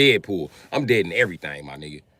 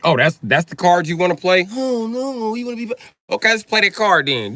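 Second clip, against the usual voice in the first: heated reply; 6 semitones higher, 7 dB louder than usual. Real, not acted. Angry reply to chat.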